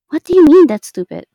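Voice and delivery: loud, offended disbelief; indignant. Real, not acted. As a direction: shoot back, insulted. Indignant reply to player.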